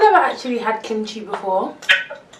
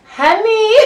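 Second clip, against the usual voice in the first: high loud shout; 12 semitones higher, 7 dB louder than usual. Real, not acted. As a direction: call out louder, higher. Louder second call.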